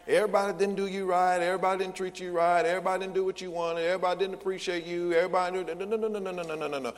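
mocking litany on one high note; mock complaining. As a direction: rattle off complaints until they blur into no-no-no. Mimicking last year's complaints.

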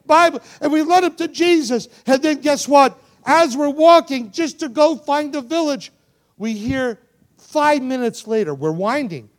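high, loud, driving testimony; impassioned preaching. Acted. Preacher telling how they led them to Jesus, impassioned preaching. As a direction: proclaim it at full force.

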